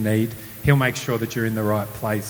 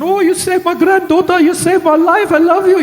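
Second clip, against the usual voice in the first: very high, loud wailing mimic; tearful grateful parents; 9 dB louder than usual. Grateful families thanking the boy, very high and loud. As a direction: gush thanks like a weeping grandparent. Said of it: "they're going".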